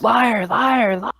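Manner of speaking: high ghostly scream of liar; tortured ghost shrieking liar. Acted. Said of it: "he just screams".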